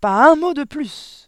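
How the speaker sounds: very loud, high, slow shout; furious drawn-out command; vociferating. A narrator's reading. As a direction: roar to silence the teacher. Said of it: "vociféra mademoiselle Le Gourdin".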